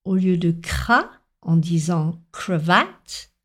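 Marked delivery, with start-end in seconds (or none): 2.3-3.3 cravate said creu-VATTE, first vowel as eu; learner's mispronunciation. Retold or imitated. Teacher quoting the pupil's slip, cravate as creu-VATTE. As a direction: say cravate with creu for cra, last syllable stressed. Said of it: "Même s'il a prononcé creux".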